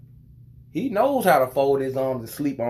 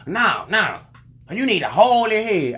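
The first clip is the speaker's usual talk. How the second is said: louder, higher insistence; insistent lady; 7 semitones higher, 6 dB louder than usual. Retold or imitated. Imitating the lady insisting on how to hold the baby. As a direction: push the correction hard.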